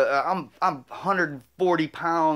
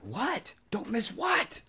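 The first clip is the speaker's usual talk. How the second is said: hushed question; 6 semitones higher, 8 dB quieter, much slower than usual. Retold or imitated. Friend asks back while hiding.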